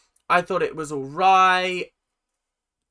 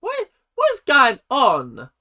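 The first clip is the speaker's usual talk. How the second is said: bewildered; 6 semitones higher, 4 dB louder than usual. Real, not acted. Bewildered by the fight.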